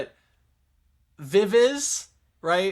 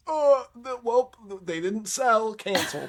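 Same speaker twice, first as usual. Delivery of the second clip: high-pitched mock fretting, drawn out; mock cancelling organizers; 10 semitones higher, slower than usual. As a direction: imitate dithering bookers in a silly high voice. Mimicking arena bookers who cancel.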